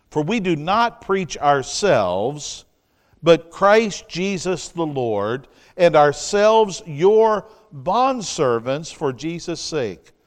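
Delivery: scripture reading. Acted.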